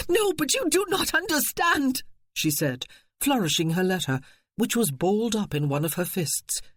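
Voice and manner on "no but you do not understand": desperate pleading. Acted.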